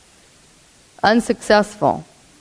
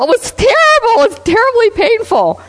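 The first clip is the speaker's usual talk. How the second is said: pained woman; 13 semitones higher than usual. Retold or imitated. Woman telling researchers about pain.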